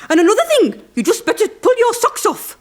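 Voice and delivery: high, loud mock soap-actress line; imitating actress. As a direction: ham up the fluffed line, high. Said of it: "she said".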